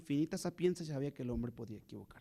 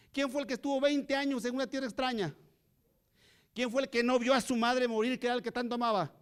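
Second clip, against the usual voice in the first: fiery preaching; 5 semitones higher, 4 dB louder than usual. Acted. Preaching build-up of questions.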